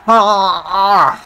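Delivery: loud, high "aah" noise; odd high cry in two bursts; weird drawn-out cry. Real, not acted. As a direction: let out a weird, loud wail. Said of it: "I don't know what that noise was".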